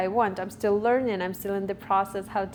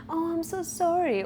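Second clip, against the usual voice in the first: high apology; 8 semitones higher than usual. Retold or imitated. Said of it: "you're like".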